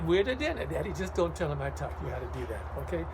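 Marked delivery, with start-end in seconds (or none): 0.1-0.9 higher, louder pleading call; whiny hungry kid. Pretend. Kid at home calling to dad for dinner. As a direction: beg like a hungry child. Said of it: "you can say".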